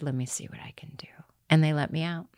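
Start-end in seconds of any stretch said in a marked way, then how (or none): 0.0-1.1 fast hushed whisper; quick, soft whisper of the producer; whispered reply. Retold or imitated. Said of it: "And she said".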